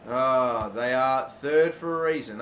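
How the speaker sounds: ordinary talk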